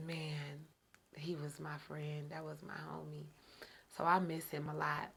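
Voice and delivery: very soft, low remembrance; real subdued grief. Real, not acted. Woman remembers her late friend, quietly mournful. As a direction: say it low and hushed, missing him.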